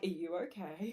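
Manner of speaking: very soft, concerned ask; soft worried question. Retold or imitated. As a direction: murmur it gently. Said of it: "both of them are like".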